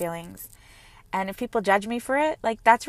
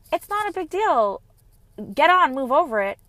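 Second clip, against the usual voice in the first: put-on high, breezy brush-off of a hurt child; mock dismissive; 6 semitones higher, 3 dB louder than usual. Mother brushing off daughter's hurt feelings, mock dismissive. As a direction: wave the hurt away lightly, in a higher voice. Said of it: "if I would tell her".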